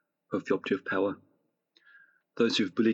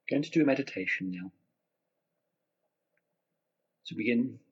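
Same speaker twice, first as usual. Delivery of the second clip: guided meditation; 4 dB quieter than usual. Acted. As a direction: gently guide them into relaxation.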